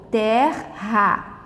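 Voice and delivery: "Terra" broken as ter, ra; split into syllables. Shown as a demonstration. Teacher showing how the word divides, syllable by syllable. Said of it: "se a gente vai separar a sílaba".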